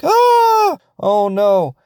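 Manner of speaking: high, loud cry of alarm into "oh no". Acted. Onlookers watching friends free-fall, high alarmed cry.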